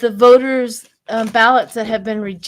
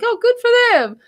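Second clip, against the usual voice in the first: cheerful; 11 semitones higher, 3 dB louder, faster than usual. Retold or imitated. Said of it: "I'm like".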